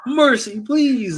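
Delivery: high, loud mock begging; Saint Saturn pleading. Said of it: "he's trying to plead his case".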